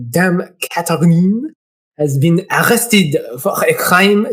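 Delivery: put-on slow, formal French-accented letter reading; measured French accent. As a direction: read it out as a Frenchman. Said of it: "He's gonna be French".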